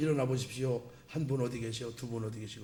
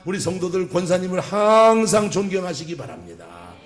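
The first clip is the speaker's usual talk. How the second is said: preaching at full force; 4 semitones higher, 8 dB louder than usual. Real, not acted. Preacher rousing the congregation, loud fervent preaching. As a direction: proclaim it to the whole congregation.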